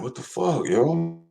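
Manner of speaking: high, softened incredulous "what the fuck"; retold disbelief. Streamer recalls his reaction to a sad year, in high hushed disbelief.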